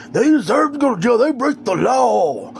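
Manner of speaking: high, whiny mock outcry, last words dragged out; exaggerated high-pitched mockery, slowing at the end; mocking idiot friends. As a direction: mimic a dumb, indignant complaint.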